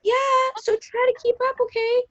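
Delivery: high snippy comeback; snippy retort. Acted. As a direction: snap it back, high. Acted quarrel, snippy.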